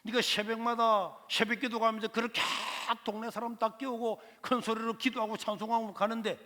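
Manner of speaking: 높고 큰 소리로 비꼬는 삼촌; 새벽기도를 비웃듯 크게 빈정대기; sarcastic uncle. Retold or imitated. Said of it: "비꼬더라고요". (기도하는 조카를 놀리는 삼촌, 높고 크게 비꼬듯)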